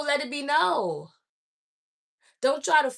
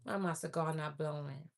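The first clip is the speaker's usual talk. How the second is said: much softer and lower, to herself; quiet aside; 7 semitones lower, 16 dB quieter than usual. Real, not acted. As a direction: drop the voice, half to yourself. Muttered aside about her cigar.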